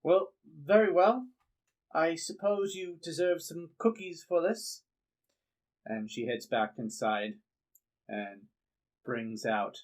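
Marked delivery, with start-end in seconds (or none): 0.0-4.8 high, fussy character voice; put-on high voice, reluctantly gracious; grudging homeowner. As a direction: concede reluctantly in a raised pitch.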